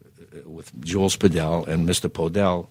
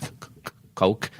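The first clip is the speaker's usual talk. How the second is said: nervous stammer. Retold or imitated. Nervous teen ordering from [name].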